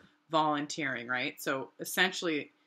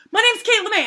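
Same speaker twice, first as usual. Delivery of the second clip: high, loud rushed introduction; hurried hopeful hello; eager blurt; 12 dB louder, faster than usual. Retold or imitated. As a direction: blurt your name out eagerly.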